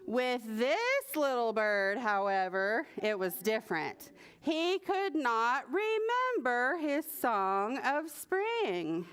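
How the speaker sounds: ordinary delivery, nothing marked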